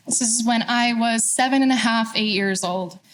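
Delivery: usual speaking voice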